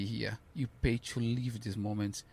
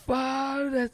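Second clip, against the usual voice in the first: much higher, louder overwhelmed yell; emotional shout; 14 semitones higher, 6 dB louder than usual. Retold or imitated. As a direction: burst out, overcome with emotion. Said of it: "We both start like".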